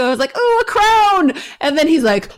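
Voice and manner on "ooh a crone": high, loud mock delight; joking excitement. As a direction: coo it high and loud, as a joke. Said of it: "I jokingly sent you".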